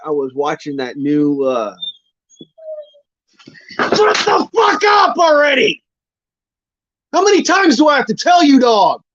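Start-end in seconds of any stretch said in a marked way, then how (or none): 3.8-9.0 much higher, louder furious yell at the dog; drill sergeant shout. Real, not acted. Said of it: "pull out my drill sergeant voice".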